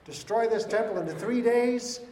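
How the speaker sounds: rising voice of Jesus, slowing on days; mild proclaiming Jesus. Retold or imitated. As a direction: declare it like a prophecy. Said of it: "Remember the time Jesus said".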